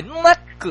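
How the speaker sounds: "なっく" with a held n bursting into a sharp stop; punchy name demo. Shown as a demonstration. Host coaches caller's self-introduction, "なっく" punched. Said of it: "って言ってみてください".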